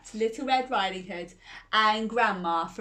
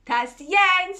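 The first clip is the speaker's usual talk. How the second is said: cheery goodbye; 7 semitones higher, 7 dB louder than usual. Real, not acted. Cheerful sign-off.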